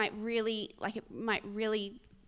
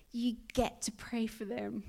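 breathy, gentle inner prompting; voice of conviction. Retold or imitated. Inner voice inviting her to pray for homeless person, gentle conviction. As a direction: half-whisper it as a kind invitation. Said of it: "that is the voice of conviction".

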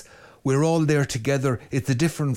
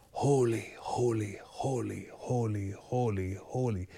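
each holy softer, fading to a hush; soft repeated prayer; 3 semitones lower, 8 dB quieter, slower than usual. Shown as a demonstration. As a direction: chant it gently, like the angels. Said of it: "aios in the Greek".

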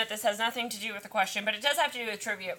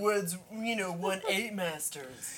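know-it-all douchebag; 2 semitones lower than usual. Retold or imitated. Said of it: "that douchebag on your pub quiz team".

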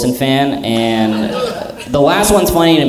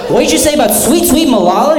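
much higher, laughing shocked gasp of a question; outraged disbelief; 13 semitones higher than usual. Pretend. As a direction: act scandalized for her sake. Friend outraged at the insult.

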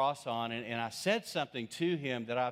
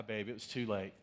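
ordinary talk, nothing marked

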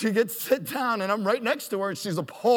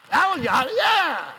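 excited whoop; 10 semitones higher, 4 dB louder than usual. Real, not acted. Cheering after the talk.

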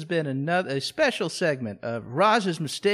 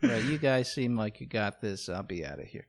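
low, soft, fading away; mock sheepish withdrawal; 8 semitones lower, 9 dB quieter than usual. Ignored Roz bows out, low sheepish fade. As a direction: back out quietly, sinking lower. Acting forgotten Roz excusing herself.

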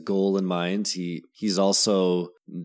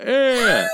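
high, loud mock creature "eh"; comic high-pitched creature noise; Predator noise; 7 dB louder than usual. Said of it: "he does like the".

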